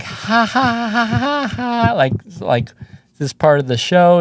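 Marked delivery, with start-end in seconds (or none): 0.0-1.9 high, even imitation laugh; mock audience laughter. Comic imitates the audience laughing, mock laughter. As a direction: fake a crowd's ha-ha-ha, high and light. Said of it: "And the audience is like".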